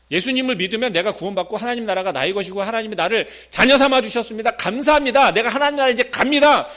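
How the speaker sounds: rising thankful declaration; eager confession. Acted. Believer confessing to Jesus, growing loud and high.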